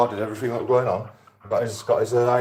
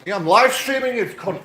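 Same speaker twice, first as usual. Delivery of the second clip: angry; 10 semitones higher, 3 dB louder than usual. Real, not acted. Tenant confronting landlord.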